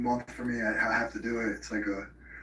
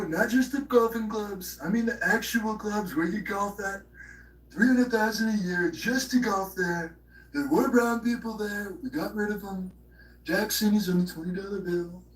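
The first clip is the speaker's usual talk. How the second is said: much higher, hammed-up retelling of dad's joke; mocking dad's joke; 8 semitones higher than usual. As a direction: squeak the joke back in a silly high voice. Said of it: "so I was like".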